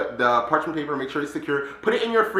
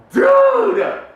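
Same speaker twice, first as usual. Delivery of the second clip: very high, loud outburst; amazed squeal; 8 dB louder than usual. Real, not acted. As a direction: cry out in astonishment. Marvelling at the candy.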